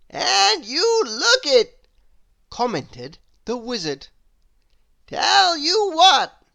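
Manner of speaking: loud, high, slow question; cheerful old wizard; jolly wizard. Acted. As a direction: ask it grandly and slow. Wizard voiced in story.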